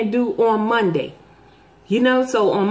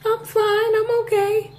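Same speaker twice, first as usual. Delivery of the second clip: coy reply; 12 semitones higher than usual. Retold or imitated. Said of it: "I was like".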